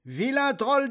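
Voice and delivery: high defiant goat; big goat. Acted. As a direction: challenge the troll boldly. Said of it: "dit Barbu".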